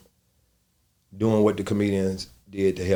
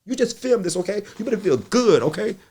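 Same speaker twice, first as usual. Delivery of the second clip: much higher, lightly voiced soothing mentor; Monique comforting; 13 semitones higher, 4 dB louder than usual. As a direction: coax the nervous comic along. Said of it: "She said".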